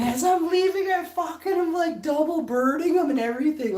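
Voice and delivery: very high, loud, gleeful retelling; triumphant exit. Retold or imitated. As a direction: crow about the big defiant walkout. Re-enacts defiant exit, voice soaring.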